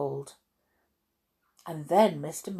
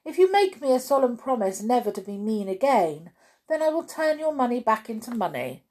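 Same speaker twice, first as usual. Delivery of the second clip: fast, higher-pitched wizard's bargain; wordy wizard; 6 semitones higher, faster than usual. A narrator's reading. Wordy wizard offering Mister Mean a deal, rattling on. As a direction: reel off the conditions briskly. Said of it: "continued the wordy wizard".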